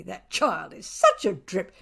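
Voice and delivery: usual speaking voice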